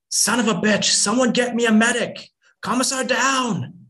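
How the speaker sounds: screaming high and loud for a medic; desperate shout. Acted. Mother on the battlefield calling for medics, desperate shout. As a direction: yell across the battlefield, voice cracking high.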